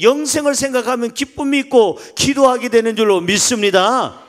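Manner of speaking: loud, high-pitched proclamation from the pulpit; full-force preaching. Real, not acted. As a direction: drive each promise home to the congregation at full volume.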